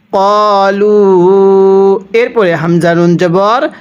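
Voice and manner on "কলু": কলু with the vowel stretched very long; long madd. Shown as a demonstration. Teacher demonstrates the long pull on কলু, drawn out. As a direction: hold the vowel of কলু for three beats. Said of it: "তিন আলিফ টানতে হয়".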